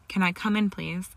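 ordinary delivery

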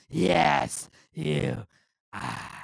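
loud boss command dropping to a low growl; Vince McMahon. Acted. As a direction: overrule him with a booming order. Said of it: "a nice little Vince McMahon impression".

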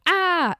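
high, loud scream of fright; kids scream. Retold or imitated. Kids in the car when the goat leg lands, screaming high and loud. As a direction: yelp as if something hit the roof.

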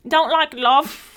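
slow, loud mock British accent; high, exaggerated British-accented teasing. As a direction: drag it out in a put-on British accent. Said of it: "do it in a British accent".

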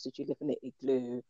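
ordinary talk

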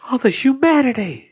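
high dramatic commentary wail; Jim Ross call. Pretend. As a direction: cry out like a stunned announcer.

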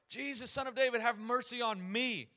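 high, loud, urgent plea; acted insistent shout from the crowd. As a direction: yell it to someone who is ignoring you. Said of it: "he shouts it out even louder".

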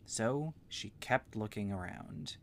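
ordinary delivery

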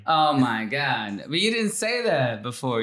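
usual speaking voice

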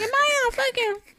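very high comic squeal; mock-panicked high question; retold disbelief. Telling friend about the storm, high mock panic.

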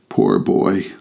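understated pity, close to plain. A narrator's reading. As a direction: say it with a touch of sorrow. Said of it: "in pity for his former self".

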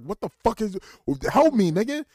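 loud, high, hammed-up demand; exasperated plea. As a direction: yell for help in mock outrage. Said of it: "I was like".

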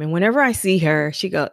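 ordinary talk

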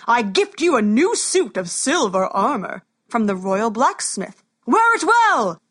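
loud royal proclamation; booming warrior king. Acted. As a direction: announce the gift to the crowd. King gifts armor.

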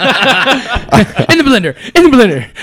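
high, loud, hammed-up movie line; movie quote impression. Quoting that movie for friends, high and hammed-up. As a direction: pitch it up and push it out comically.

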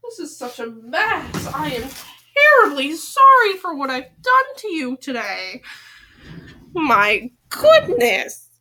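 mock-formal apology. Apologizes to the toy.

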